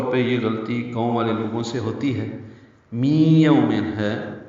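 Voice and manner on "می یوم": "می یوم" said very slowly with the nasal sound held; nasal merge. Shown as a demonstration. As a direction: glide the ending into the next word, slowly. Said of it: "ادغام ناقص ہے، غنہ ہوگا". (غنہ کے ساتھ آہستہ ادغام)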